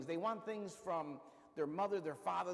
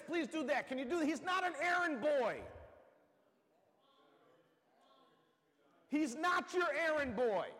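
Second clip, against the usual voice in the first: preaching; 9 semitones higher, 6 dB louder than usual. Acted. Preacher exhorts congregation.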